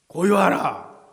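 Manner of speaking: 크게 울리는 호령; 폭풍을 잠재우려는 힘찬 명령; 단호한 명령. Acted. 예수가 바다에 명령. (우렁찬 명령조)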